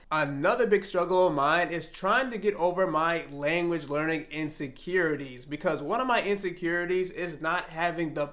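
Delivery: normal talking voice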